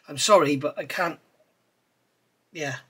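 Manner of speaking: normal talking voice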